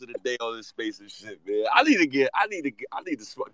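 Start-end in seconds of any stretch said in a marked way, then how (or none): none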